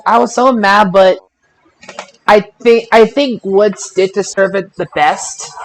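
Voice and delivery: normal talking voice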